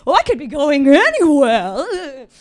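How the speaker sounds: sat nav voice being flicked around the map, loud wail; whining Google Maps. Pretend. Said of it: "He's like".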